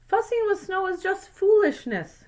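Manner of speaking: a hint of a scolding father brushing off the idea; half-playful father voice, mildly dismissive; stern father. Father scolds son in story.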